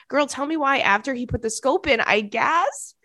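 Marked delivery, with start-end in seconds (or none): none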